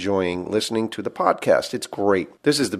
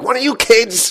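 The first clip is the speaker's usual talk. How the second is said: high-pitched mock parental scolding; 11 semitones higher, 4 dB louder than usual. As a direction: imitate a parent telling off the kids. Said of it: "they'd be like".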